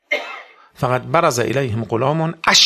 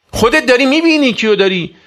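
shouted much higher and louder; furious rebuke; 10 semitones higher, 8 dB louder than usual. Acted. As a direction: hurl the reproach at the attacker. Rebuking the enemy attacking.

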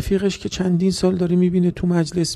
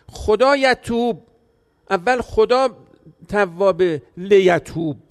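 slow Quranic recitation; much slower than usual. Acted.

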